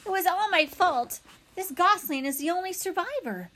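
high-pitched, incongruously cheery confession; cheerful. A narrator's reading. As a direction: confess it in a friendly, upbeat robot voice. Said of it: "Why does your voice sound so cheerful?".

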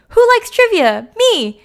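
very high, loud, squealed quiz question and answer; gleeful mock announcement; excited Olaf impression. Retold Olaf announcing trivia excitedly.

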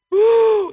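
a high, loud whoop of delight; joyful whoop. Real, not acted. Preacher to congregation before the scripture reading, with a loud joyful whoop. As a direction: whoop it out with real joy.

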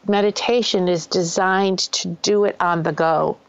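ordinary talk

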